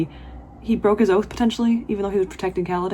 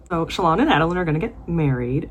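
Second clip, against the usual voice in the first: ordinary delivery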